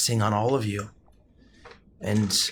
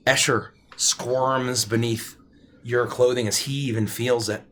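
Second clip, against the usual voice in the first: normal talking voice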